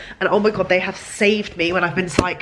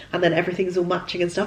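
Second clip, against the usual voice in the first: normal talking voice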